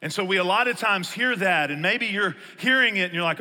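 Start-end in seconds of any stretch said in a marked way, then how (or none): none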